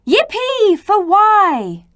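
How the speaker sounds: high, loud, slow cheer; children cheering for Y. Acted. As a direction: stretch out a big happy cheer. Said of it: "The children cheered".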